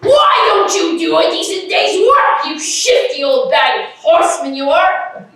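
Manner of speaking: shouted retort, loud and high; mock indignant shout at a beggar; retold shout. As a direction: bark it like an angry young man.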